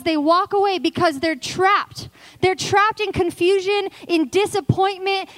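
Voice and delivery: high, driving, urgent preaching; full-force preaching. Acted. Preacher lamenting those who walk away trapped, high and urgent.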